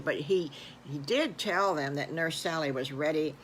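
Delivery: ordinary delivery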